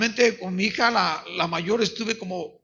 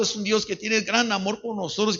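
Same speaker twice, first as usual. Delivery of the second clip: ordinary delivery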